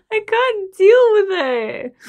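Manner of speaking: high, loud and slow anguished cry; can't take the loss; overwhelmed grief. Real, not acted. Viewer grieving a dead pilot, slow anguished cry.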